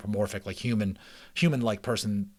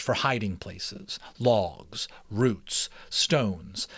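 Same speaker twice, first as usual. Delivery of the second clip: steady, lively read-aloud narration; story narration; 3 semitones higher, 4 dB louder than usual. A narrator's reading. Reading a mouse adventure to listeners, storytelling voice. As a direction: read it like a chapter book to listeners. Reads chapter book aloud.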